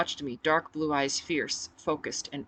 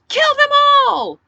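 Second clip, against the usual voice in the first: mob yell; 17 dB louder than usual. Acted. Crowd turning into mob.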